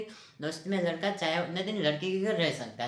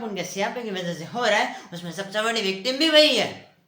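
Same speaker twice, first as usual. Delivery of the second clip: exasperated rant; 2 semitones higher, 6 dB louder than usual. Acted. Man ranting about who plays victim, exasperated outburst. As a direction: rise into an indignant outburst.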